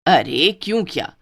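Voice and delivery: usual speaking voice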